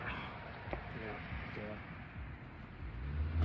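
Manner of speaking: drawn-out quiet sigh; long low sigh. Real, not acted. Caller sighing after telling about his ex. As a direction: let out a long weary sigh.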